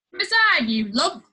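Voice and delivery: loud, high shout at the politician; angry yell. A narrator's reading. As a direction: yell it at him from the crowd. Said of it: "one angry lady yelled".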